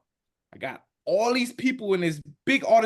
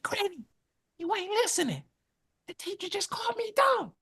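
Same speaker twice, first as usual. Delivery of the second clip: whisper; 14 semitones higher, 4 dB quieter than usual. Retold or imitated. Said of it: "I said".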